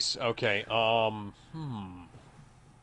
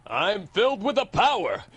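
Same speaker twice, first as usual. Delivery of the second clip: a lightly heroic He-Man, higher and bold; 8 semitones higher than usual. Retold or imitated. Quoting He-Man, claiming the power of Grayskull, mock-heroic. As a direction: proclaim like a cartoon hero raising his sword. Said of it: "He-Man".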